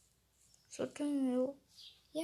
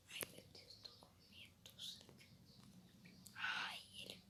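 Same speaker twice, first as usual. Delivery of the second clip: frightened whisper; 19 dB quieter than usual. Real, not acted. Hiding in horror game, scared.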